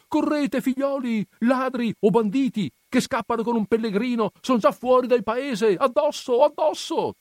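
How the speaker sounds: very high, loud rallying cry; shouted alarm. Acted. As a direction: spur them into the chase. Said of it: "e grida".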